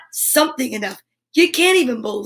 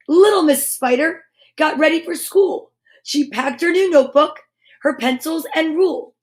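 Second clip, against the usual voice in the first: put-on reading to children; 3 semitones higher than usual. Reading picture book to kids.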